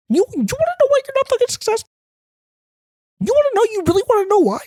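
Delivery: very high, fast squeaky guru voice; mock hustle guru. To the audience, a fake motivational guru teasing why they fail, squeaky high and fast. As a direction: squeak it out like a hyped hustle coach.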